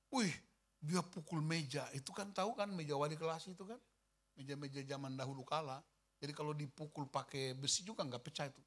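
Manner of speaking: ordinary talk, nothing marked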